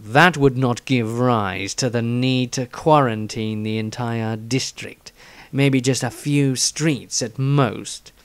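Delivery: uneasy man pondering in his journal, slow and dark; Dieter diary voice. Acted. S0 reads Dieter's diary again.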